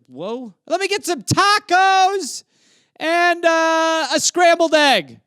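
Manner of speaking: high, slow dazed customer voice; high, slow put-on voice ordering breakfast tacos; stoned customer.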